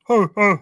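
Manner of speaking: high loud sobbing huh huh; mock crying child sobs. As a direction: sob like a crying kid. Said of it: "I didn't know the crying child went".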